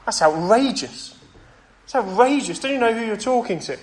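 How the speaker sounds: scandalised at the nerve of it; indignant inner voice. Retold or imitated. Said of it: "I thought".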